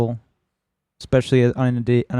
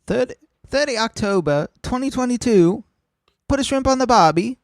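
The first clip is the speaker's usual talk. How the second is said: higher, hammed-up Aussie twang; over-the-top Australian accent, much higher; mock Australian accent; 12 semitones higher than usual. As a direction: play a cartoon Australian bloke. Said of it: "he has tried to be Australian, mate".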